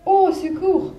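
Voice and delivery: high, loud cry for help; a fox fleeing the gruffalo; frightened cry. A narrator's reading. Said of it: "il cria".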